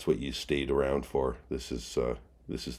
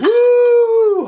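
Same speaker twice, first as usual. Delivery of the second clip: very high, loud cheering whoop; excited whoop; 18 dB louder than usual. Real, not acted. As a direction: let out a triumphant stadium whoop. Cheering after finishing anthem.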